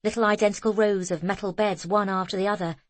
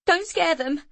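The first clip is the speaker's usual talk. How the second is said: shouted; 9 semitones higher than usual. A narrator's reading. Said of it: "shouted Zoe".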